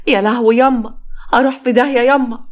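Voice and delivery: high panicked wail to her mother; wailing lament. Acted. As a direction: wail it like a mourner beating her head. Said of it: "وبتندب وبتخبط في دماغها".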